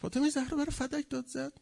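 soft but high-pitched, pained; hushed repeat. Real, not acted. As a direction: let it sink in softly, voice held high. Repeats the shouted line softly. (آهسته با صدای زیر)

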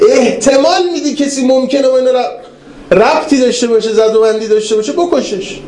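a hint of a heartless governor; ruthless ruler's orders. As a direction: voice the tyrant giving curt kill orders.

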